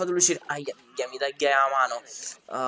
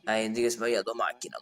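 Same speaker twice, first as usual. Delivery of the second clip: usual speaking voice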